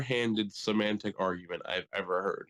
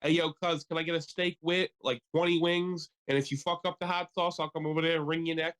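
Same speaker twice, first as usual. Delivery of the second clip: put-on higher-pitched Philly accent; 6 semitones higher than usual. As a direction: play a rowdy Philly guy, Philly accent. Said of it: "nobody was doing a Philly accent".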